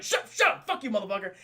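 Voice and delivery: acted exasperated snapping; mock angry outburst. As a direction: shout them down, imitating a furious director.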